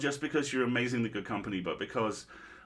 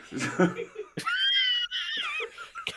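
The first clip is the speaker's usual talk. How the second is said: long high laughing shriek. Real, not acted. Big laughing outburst.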